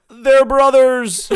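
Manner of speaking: loud, slow announcer call; boxing announcer. Retold or imitated. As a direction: drag it out like a ring announcer. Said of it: "ladies and gentlemen".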